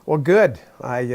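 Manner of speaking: ordinary talk, nothing marked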